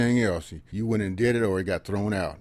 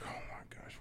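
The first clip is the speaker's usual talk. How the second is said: whispered thought; 22 dB quieter than usual. Retold or imitated. Thinking after uncle starts crying.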